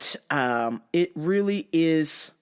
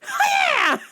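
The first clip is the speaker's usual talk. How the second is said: high laughing karate cry; karate yell; 3 dB louder than usual. Retold or imitated. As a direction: shout it like a karate chop. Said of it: "They're like".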